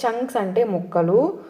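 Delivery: ordinary talk, nothing marked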